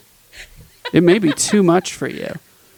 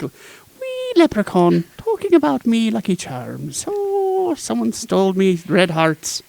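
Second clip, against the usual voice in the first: hammed-up leprechaun Irish, slow and higher; horrible Irish accent; 5 semitones higher, slower than usual. Host mocking St Patrick's theme in a bad Irish accent. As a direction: ham up a wee leprechaun in Irish. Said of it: "my Irish accent is horrible".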